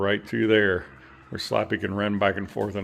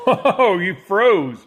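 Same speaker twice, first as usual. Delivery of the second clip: laughing; 8 semitones higher, 4 dB louder, much slower than usual. Real, not acted. Pet freezes, owner laughs.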